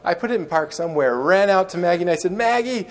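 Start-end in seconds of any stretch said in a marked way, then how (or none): 2.3-2.9 high excited cry of her name; joyful call. Retold or imitated. Man spotting his lost dog in the street, high happy call.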